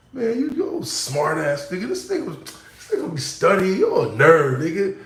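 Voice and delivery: put-on jeering kid calling him a nerd; mocking classmates. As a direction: tease him like a schoolyard bully.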